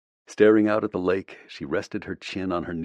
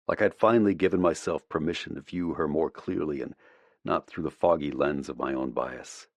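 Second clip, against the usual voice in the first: ordinary delivery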